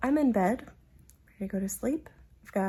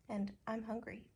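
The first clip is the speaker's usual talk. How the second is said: normal talking voice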